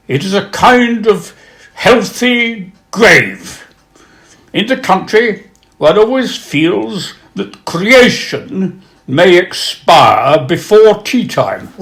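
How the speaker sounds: slow, high, drawn-out complaint; hammed-up witticism. Wit who can't abide the country, comic lament. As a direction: stretch the gloomy words for comic effect. Performs Sidney Smith's witticism.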